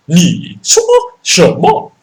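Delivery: slow, high, loud sing-song mock Chinese; fake Chinese. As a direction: stretch the rising tones like mock Mandarin. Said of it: "how to speak fake Chinese".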